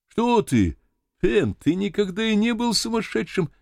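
high-pitched reassuring protest; успокаивающий Сальтерс. Acted. (высоким голосом, успокаивая друга)

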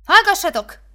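loud, high shout of silence; shouted command. Acted. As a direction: hush them all at once. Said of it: "kiáltott".

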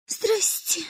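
put-on tiny high-pitched child greeting; timid squeak. A narrator's reading. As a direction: squeak out a nervous hello. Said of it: "пискнула я".

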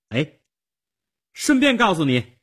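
high, loud call from above. A narrator's reading. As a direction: call to someone in the cellar. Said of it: "他对着下面的地窖说". (朝下面大声说)

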